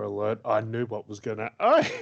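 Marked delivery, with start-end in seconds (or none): 1.6-1.9 surprised. Real, not acted. Sudden realization mid-sentence.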